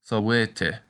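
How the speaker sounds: "sawete" with the middle syllable stressed, sa-WAY-te. Shown as a demonstration. Teacher showing Latin stress on second syllable. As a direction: hit the A in "sawete". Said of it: "with the accent on the A".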